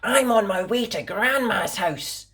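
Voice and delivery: put-on high, childlike promise; little girl voice. A narrator's reading. Girl promising her mummy, high and earnest. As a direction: sound like a small girl promising.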